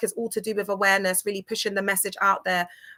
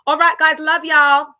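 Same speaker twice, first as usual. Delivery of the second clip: loud, high, bright send-off; excited goodbye; 6 semitones higher, 9 dB louder than usual. Real, not acted. Host signing off to her followers, loud and excited. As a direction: burst out with a big farewell.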